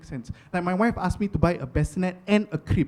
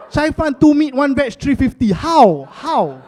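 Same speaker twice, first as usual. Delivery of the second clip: high, loud, incredulous rant; comic disbelief; 8 semitones higher, 8 dB louder, slower than usual. Acted. Comic baffled by cheap rice prices, loud incredulity. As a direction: demand an answer in mock bafflement.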